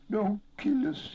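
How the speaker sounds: very high, slow wail; tearful, high-pitched creature's whimper; weeping plea. A narrator's reading. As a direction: beg for your life, wailing. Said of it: "he wept".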